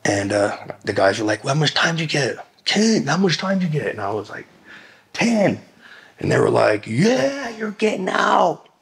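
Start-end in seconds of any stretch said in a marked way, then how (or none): 1.4-3.9 high-pitched imitation of inmates hollering a question; imitated calling out. Inmates asking about the sentence, calling out high. 5.1-5.6 high-pitched shouted-back reply; imitated calling back. Telling the other inmates his sentence, calling out high. 6.9-8.7 very high, drawn-out celebratory holler; imitated cheering. Inmates celebrating his news, imitated high whooping cheer.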